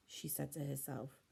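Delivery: ordinary delivery, nothing marked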